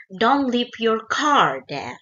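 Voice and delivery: slow model sentence with "car" hit hard; "car" carries the main stress, said slowly and clearly. Shown as a demonstration. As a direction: lean into "car" as the key word. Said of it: "penekanannya adalah di car".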